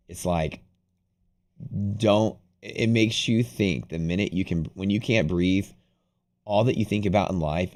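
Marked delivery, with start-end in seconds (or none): none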